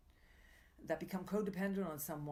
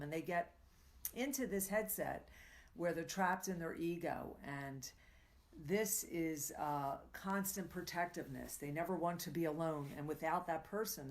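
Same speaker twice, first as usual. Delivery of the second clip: ordinary talk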